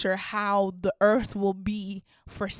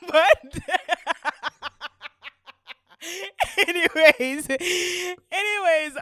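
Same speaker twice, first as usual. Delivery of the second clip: laughing; 7 semitones higher, 5 dB louder, much slower than usual. Real, not acted. Laughing at own joke.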